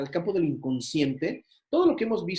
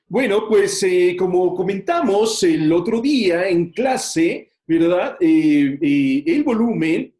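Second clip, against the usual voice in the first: much louder, slow, projecting to the room; teacher demoing too much volume; loud classroom voice; 4 semitones higher, 11 dB louder, slower than usual. Shown as a demonstration. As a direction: boom it out like you're calling across a classroom. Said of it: "otra cosa es que grites".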